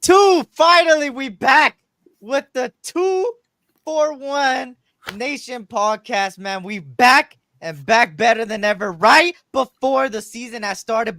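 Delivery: loud, high, pumped-up show opener; hype podcast intro. Real, not acted. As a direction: open the show like an announcer hyping the crowd.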